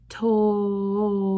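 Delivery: "Toll" sustained for four beats; held toll. Shown as a demonstration. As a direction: hold the word toll long and steady. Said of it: "we're gonna say it just like this".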